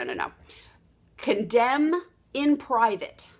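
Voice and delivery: ordinary delivery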